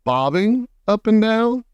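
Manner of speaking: startled, throw the words back; cried out. A narrator's reading. Creatures crying out in alarm, high and startled. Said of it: "they cried".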